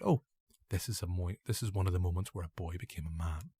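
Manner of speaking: low, softly intoned awe; mock-solemn hushed reverence. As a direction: murmur it deep and quiet. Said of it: "Did you do it in that way?".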